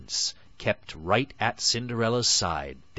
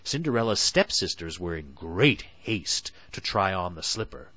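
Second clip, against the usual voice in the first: usual speaking voice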